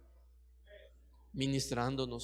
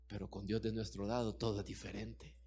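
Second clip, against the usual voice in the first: ordinary delivery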